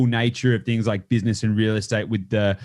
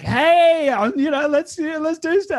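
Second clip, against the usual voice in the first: high, bright-pitched eager caller; eager client voice. Retold or imitated. Client on the phone, eager and high-pitched. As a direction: sound like a keen client ringing up.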